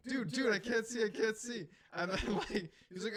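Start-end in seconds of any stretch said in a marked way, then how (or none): 0.1-1.7 loud, high mock panic at the buddy; imitating panic. Blocked at the wheel by his buddy, mock panic. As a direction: yell it as if you can't see the road.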